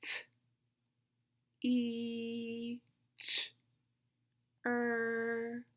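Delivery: isolated sounds with long pauses; super slow sounds. Shown as a demonstration. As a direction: stretch "E" and "er", keep "T" and "ch" short. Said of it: "Listen again".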